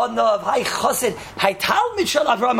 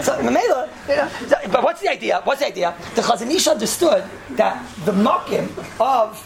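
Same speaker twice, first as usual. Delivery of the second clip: normal talking voice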